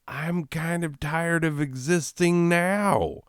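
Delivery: high-pitched, hammed-up complaint; comic weariness. Answering those who hope to exist forever, comically weary.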